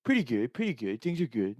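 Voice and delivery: lightly put-on cartoon character voice; reply as the cartoon character; a hint of cartoon boy voice; Jimmy from South Park. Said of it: "Jimmy character from South Park voice".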